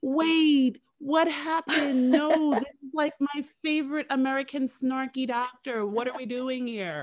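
stunned fan protesting the reveal; mock disbelief. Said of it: "it was like".

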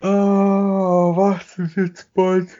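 high, slow, loud disbelief; stunned high exclamation; surprised. Real, not acted. Reacting to crash story.

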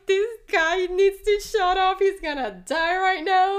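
imitating bystanders who panic at a bold remark; alarmed outcry. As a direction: shout it like the whole room is panicking. Everybody reacting, alarmed.

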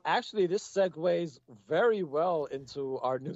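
ordinary talk

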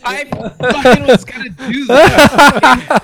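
slow, loud, laughing complaint; laughing protest. Real, not acted. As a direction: laugh through it, mock outraged. Laughing protest at stolen pick.

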